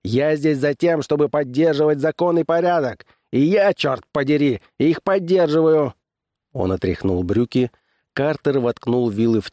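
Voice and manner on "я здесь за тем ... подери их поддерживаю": higher, firm declaration; barked retort. A narrator's reading. Constable asserting law and order, mild bark. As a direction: lay down the law. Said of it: "рявкнул Чет".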